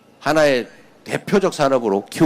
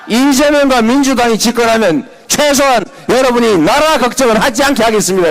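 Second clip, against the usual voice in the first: loud, high campaign cry; rally shout; 7 semitones higher, 4 dB louder than usual. Real, not acted. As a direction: shout the promise to the crowd. Rally climax, promise shouted.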